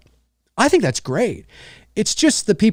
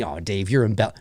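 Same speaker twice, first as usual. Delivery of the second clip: doubting viewer; 7 semitones lower, 7 dB quieter than usual. Retold or imitated. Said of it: "viewers are thinking".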